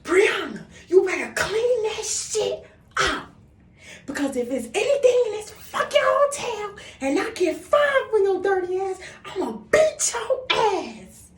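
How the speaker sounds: high, slow, loud threatening mom; angry mother. Retold or imitated. Mother threatening daughter over hotel fine, high slow furious yell. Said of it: "And she like".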